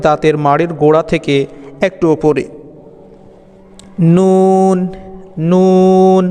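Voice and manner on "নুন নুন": নুন with tongue tip behind the upper teeth, nasal hum held; Arabic letter nun. Shown as a demonstration. Teacher demonstrating নুন to students, drawn out. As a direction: chant নুন long and ringing. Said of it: "এভাবে আপনারা নুন হরফটি উচ্চারণ করবেন".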